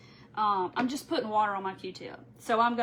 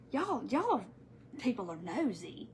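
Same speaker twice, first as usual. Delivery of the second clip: mumbling; 2 semitones lower, 8 dB quieter, slower than usual. Retold or imitated. Retelling the cashier's remark, soft mumble. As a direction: mutter it under your breath. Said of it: "he was mumbling".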